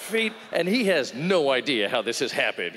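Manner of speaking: ordinary talk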